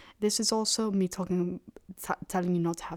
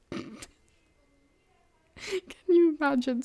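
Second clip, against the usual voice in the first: laughing; 9 semitones higher, 4 dB louder than usual. Real, not acted. Laughing disbelief.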